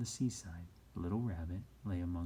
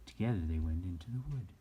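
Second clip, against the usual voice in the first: ordinary delivery, nothing marked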